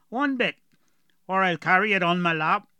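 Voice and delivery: put-on gruff dwarf with a heavy rural accent; strong dwarf accent. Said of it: "Galoric had a very strong accent".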